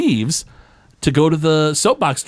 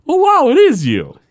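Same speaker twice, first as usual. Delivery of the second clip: much higher, louder pained cry; mock-pained delighted shout; Barney in pain; 13 semitones higher, 5 dB louder than usual. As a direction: yelp it out like you just got sprayed in the face. Barney maced, screams then answers.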